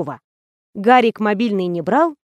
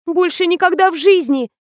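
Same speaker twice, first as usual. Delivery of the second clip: high, distressed exclamation; on the verge of crying, pitched up; close to tears; 8 semitones higher, 3 dB louder than usual. A narrator's reading. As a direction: protest as tears threaten. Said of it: "чуть не заплакала Лена".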